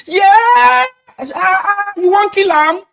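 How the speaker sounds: very high, loud protest, slowing at the end; shrill hammed-up protest, very high; mock outraged crowd voice. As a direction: squeal in fake outrage at the slap. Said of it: "And the boy is going".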